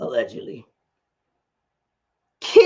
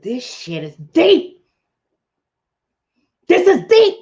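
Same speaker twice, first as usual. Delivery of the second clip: awed; 5 semitones higher, slower than usual. Retold or imitated. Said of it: "I said".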